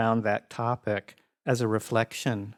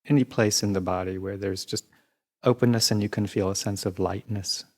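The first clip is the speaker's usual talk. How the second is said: even, unhurried meditation voice with long pauses; guided meditation. Real, not acted. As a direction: guide listeners calmly through the body, leaving space between phrases.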